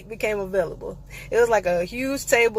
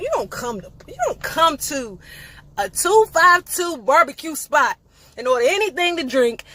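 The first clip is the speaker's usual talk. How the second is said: emphatic rule; 6 semitones higher, 4 dB louder than usual. Real, not acted. Laying down the rule.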